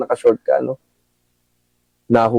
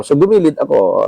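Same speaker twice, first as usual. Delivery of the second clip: ordinary talk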